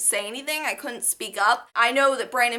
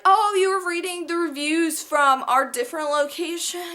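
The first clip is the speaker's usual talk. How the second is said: mock saleswoman; 5 semitones higher than usual. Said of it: "She's like".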